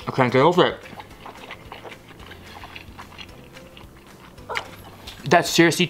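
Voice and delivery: muffled words between bites; talking while chewing. Real, not acted. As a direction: mouth full of food. Seen 0: Bit pizza at -4s; cheeks full, jaw chewing through the words.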